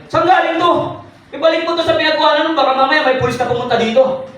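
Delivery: high, loud, fast scolding; startled angry father; alarmed father. Retold or imitated. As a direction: demand where the car came from.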